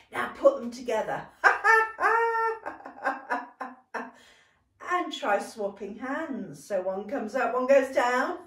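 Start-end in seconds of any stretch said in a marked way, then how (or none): 1.3-3.7 high pretend laugh trailing into staccato giggles; ticklish laugh. Teacher doing both circles together, high ticklish laughter. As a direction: squeal with laughter as if tickled all over. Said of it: "imagine it's quite ticklish".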